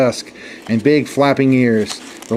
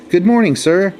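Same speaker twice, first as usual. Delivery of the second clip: polite, deferential lion, lightly voiced; a humble lion asking politely; very respectful; 6 semitones higher than usual. A narrator's reading. Lion politely greeting the camel, very respectful. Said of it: "said very respectfully".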